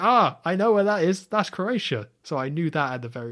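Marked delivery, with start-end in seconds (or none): 0.0-2.1 higher, louder voice of sudden recognition; excited recognition. Retold or imitated. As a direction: blurt it out like you've just spotted a familiar place. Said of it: "so I was like".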